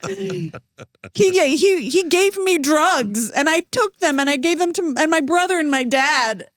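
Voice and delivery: high, slow, building panicked babble; frantic confession. Retold or imitated. Telling Itai about the shaman, climbing to high frantic babble. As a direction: blurt it out in rising panic.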